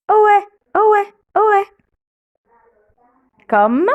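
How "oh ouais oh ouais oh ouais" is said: "oh ouais" as o gliding into an open è; higher-pitched demo of "oh ouais", both vowels sounded; separated vowels o-è. Shown as a demonstration. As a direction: chant "oh ouais" as a high two-vowel model, o then è. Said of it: "on le prononce".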